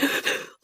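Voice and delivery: sharp gasp sucking in air. Shown as a demonstration. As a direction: suck in air fast to hold back tears. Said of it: "sucking in the air to try to stop crying".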